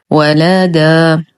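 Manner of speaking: ولدا recited very slowly with thin vowels; all light letters. Shown as a demonstration. Teacher modelling light letters, slow recited ولدا. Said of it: "الكلمة كلها مرققة".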